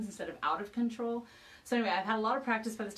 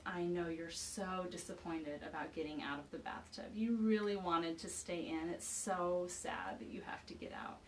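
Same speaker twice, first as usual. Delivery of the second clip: calm voice; 5 dB quieter than usual. Retold or imitated. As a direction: soothe an upset child gently and quietly. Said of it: "with a calm voice".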